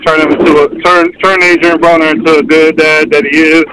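ordinary talk, nothing marked

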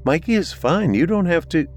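a lightly voiced mother on the phone; mother's voice. Mother answering daughter's call.

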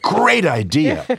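high, drawn-out delight; retold gleeful agreement. Gary agrees to prank David, gleeful relish. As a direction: relish the plan, stretching the words.

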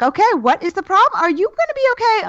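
midwife rattling off questions high and quick; fast high midwife voice. Retold or imitated. Midwife asks the crying mother what's wrong, high and fast. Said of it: "And she's like".

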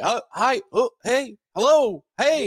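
mock host prompts. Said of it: "every call's like".

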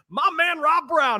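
loud, high hype-man shout; big excited call-out of the guest; hype introduction. Real, not acted. Hyping up the guest.

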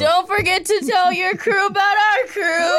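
high, loud, slow hype with a laugh; excited shout. Real, not acted. Host hyping the podcast to listeners at the sign-off, excited shout. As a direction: sell the outro, laughing. Hyped podcast outro plug.

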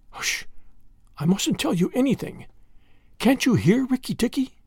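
high, hushed anxious little voice; timid warning. A narrator's reading. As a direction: warn nervously, as if someone might overhear. Terrified muskrat warns of danger.

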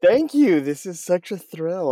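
high, loud, excited thanks; bursting with real delight at the welcome; thrilled. Real, not acted. Narrator thanking the host for the invitation, high and thrilled. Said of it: "I'm so excited to be here".

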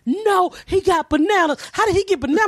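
put-on high insistent kid; excited child protest. Child protesting to her teacher, high insistent. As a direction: plead like a stubborn child.

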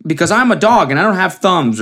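high-pitched mock dog voice; high, goofy dog caption voice; talking dog. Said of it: "And it was like".

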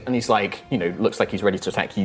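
ordinary talk, nothing marked

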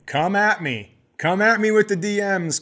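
defiant challenge. Real, not acted. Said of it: "challenging listeners".

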